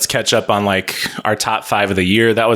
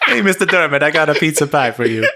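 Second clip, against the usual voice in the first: high, hammed-up Italian pizza man; stupid Italian accent, amused and pitched up; bad Italian accent; 6 semitones higher than usual. Podcaster teases Dermot with pizza, mock Italian accent. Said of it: "if you do a bad Italian accent".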